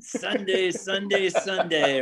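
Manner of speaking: high hyped announcer chant; Sunday Sunday Sunday promo call; monster truck ad. Retold or imitated. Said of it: "monster truck rally approach".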